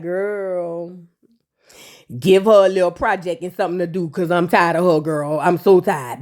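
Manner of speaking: low, tired mock aside; weary aside. [name] complaining about Amara, low and worn out. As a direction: sigh it out, fed up.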